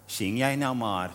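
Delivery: soft, slow, pitying reply; a slow, soft, pitying "just sing, you"; pitying brush-off. Retold or imitated. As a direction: wave them off with quiet pity. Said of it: "keek Leni altijd een beetje meewarig en zei".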